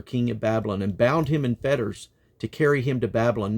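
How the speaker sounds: measured, declaiming reading; scripture reading. Acted. Reader to listeners, measured solemn reading. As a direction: read the list of enemies with weight.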